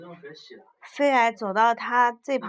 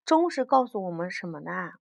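plain reading voice

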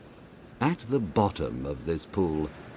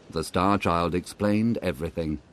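plain reading voice

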